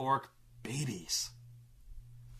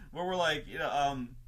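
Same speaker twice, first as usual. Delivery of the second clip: ordinary talk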